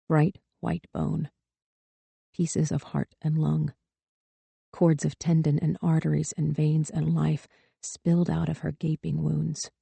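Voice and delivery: put-on girl staring at her dead mother, hushed; hushed horror. A narrator's reading. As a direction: let the horror sink in quietly.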